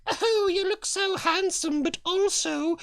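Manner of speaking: put-on prim older lady. Rich older wife complimenting her husband, high prim voice.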